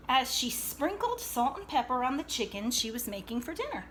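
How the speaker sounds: plain reading voice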